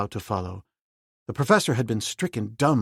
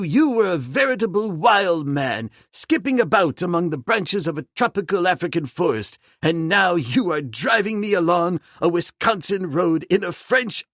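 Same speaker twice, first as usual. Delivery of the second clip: put-on excitable old Mister Philander; 7 semitones higher, 5 dB louder than usual. Philander marvels at Tarzan driving him, astonished old gentleman. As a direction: marvel at it all like a fussy old scholar.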